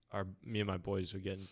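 ordinary talk

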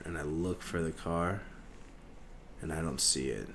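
usual speaking voice

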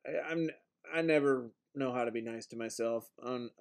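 usual speaking voice

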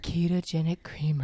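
low, creepy whisper. Shown as a demonstration. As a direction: breathe it like a creep. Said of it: "You sound like such a creep".

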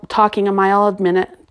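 ordinary delivery, nothing marked

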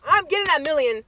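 ordinary talk

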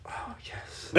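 secret victory whisper; whispered delight. Retold or imitated. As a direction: celebrate privately under your breath. Quietly thrilled at being called stepdad.

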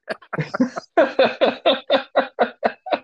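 long high giggling fit; sustained high, rapid laughter. Real, not acted. Host cracking up at own circus joke, long high laugh. Joke about Joe Exotic.

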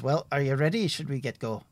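a cheerful forest ranger chatting; friendly woodsman Tim. Acted. GM voices woodsman NPC.

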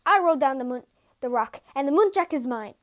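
loud, eager claim; crying out. A narrator's reading. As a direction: call it out loudly to claim the prize. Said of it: "hurried down the mountain and cried".

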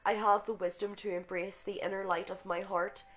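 fervent spoken invocation; prayer. Acted. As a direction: proclaim the prayer with conviction. Reads invocation and healing prayer.

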